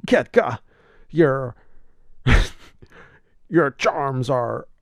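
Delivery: slow, breaking-up Batman line; slowly, fighting laughter; Adam West Batman. Retold or imitated. Batman addresses Kitka.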